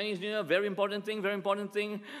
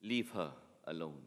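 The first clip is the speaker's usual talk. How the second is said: calm authority; 12 semitones lower, 8 dB quieter than usual. Retold or imitated. Jesus confronting the bully, low soft authority. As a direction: let quiet authority make them back off. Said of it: "enough authority in his voice".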